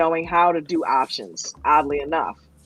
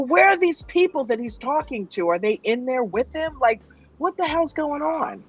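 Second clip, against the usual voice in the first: higher-pitched incredulous questions; retold bewilderment; 6 semitones higher than usual. Recalling first watching coach's live stream, retold bewilderment. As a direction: wonder aloud, baffled by what is going on.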